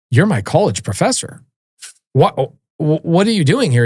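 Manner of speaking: astonished student, retold; stunned disbelief. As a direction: retell a baffled double take at an unexpected visitor. Stunned on seeing his professor.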